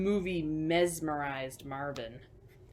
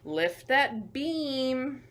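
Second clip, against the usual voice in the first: louder, slow drawn-out command; slow loud call-out; 5 semitones higher, 7 dB louder, much slower than usual. Acted. To the children, a slow, loud construction order. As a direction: bellow it slowly like a site boss.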